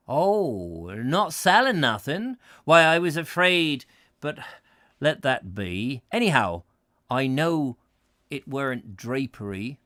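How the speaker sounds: a put-on rustic West Country accent, slowed; old Devon pedlar, West Country accent, lightly done. Voices the old pedlar, relieved.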